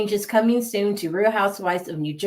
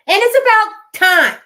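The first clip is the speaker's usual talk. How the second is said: loud, higher, emphatic; 12 semitones higher, 9 dB louder than usual. Real, not acted. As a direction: belt it out like finally, at last. Emphatic relief at cancellation.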